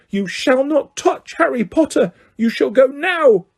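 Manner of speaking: high, commanding elf voice; high elf threat. Acted. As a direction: drive the wizard off. Dobby threatening Malfoy.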